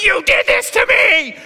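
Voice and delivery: high, loud, slow mock shriek; hammed-up accusing labor yell; mock wife in labor. Wife in delivery room blaming husband, hammed-up shriek. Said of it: "my wife looks at me and he's like".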